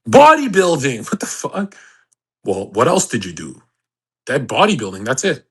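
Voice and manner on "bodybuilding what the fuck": high, scoffing disbelief; incredulous. Real, not acted. Streamer answering a fan's question, scoffing in high disbelief. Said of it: "incredulous reply".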